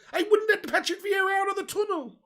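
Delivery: hammed-up indignation, voice shooting right up; mock outrage. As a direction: squeal it in mock fury. Riled quote about fighting Vieira.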